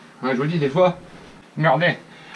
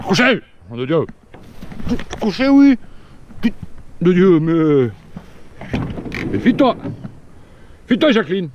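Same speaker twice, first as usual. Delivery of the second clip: shouting commands; 2 semitones higher than usual. Real, not acted. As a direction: bark orders at the dog. Shouting at the dog.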